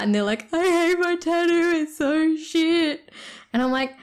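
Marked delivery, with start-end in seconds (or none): 0.5-3.1 mock crying contestant. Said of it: "bawling their eyes out".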